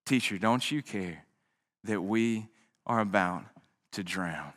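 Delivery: too worn out to shout; exhausted plea. Acted. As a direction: disciples with no strength left asking their teacher. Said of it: "these disciples forgot it as well".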